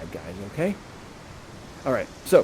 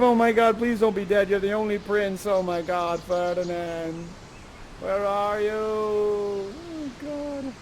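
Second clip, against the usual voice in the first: fading despair; 4 semitones higher, slower than usual. Acted. The king fears his son dead, wailing fading out. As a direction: let the wail drain away into hopelessness. Desperate search fades into despair.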